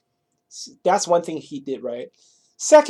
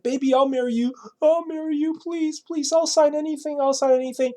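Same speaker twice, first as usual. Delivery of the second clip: higher, imitated tearful begging; mock crying plea; 9 semitones higher than usual. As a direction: play the bride pleading through fake tears. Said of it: "the woman came crying".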